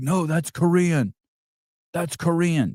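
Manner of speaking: high, drawn-out mimicry of a nitpicker; mock-pedantic objection in a high voice; mock correcting. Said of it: "You're like".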